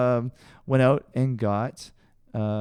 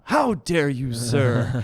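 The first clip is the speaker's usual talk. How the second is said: mock outrage; much slower than usual. Telling off people who mistook his style, hammed-up outrage. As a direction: play the offended gentleman, tongue in cheek. Retold mock indignant reply.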